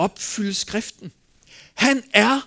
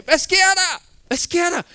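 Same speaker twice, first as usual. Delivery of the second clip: high, raised cry of alarm; disciples' alarmed cry; 10 semitones higher, 4 dB louder than usual. Retold or imitated. Disciples crying out in the boat, alarmed high-pitched call. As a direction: call out in panic, pitch jumping up. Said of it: "Ligesom disciplene råbte i båden der".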